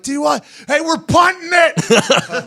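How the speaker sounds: high, drawn-out shout; hammed-up hollered signal; mock play call. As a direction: yell it out to the whole line. Shouts joke play call in story.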